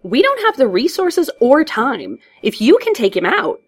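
high, loud, swooping protest; exasperated outburst. Acted. Officer tells Batman there are no resources, exasperated. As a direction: protest loudly, at the end of your rope.

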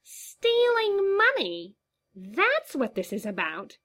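very high, slow, mocking squeal breaking into a cackle; the clown finds the whole thing hilarious; laughing clown. A narrator's reading. The clown mocks the embezzlement story with shrill glee. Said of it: "The clown erupted into laughter".

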